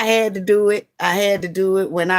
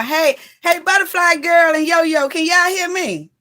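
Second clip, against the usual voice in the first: calling out; 8 semitones higher than usual. Real, not acted. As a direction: hail them across the room. Calling out to viewers joining live.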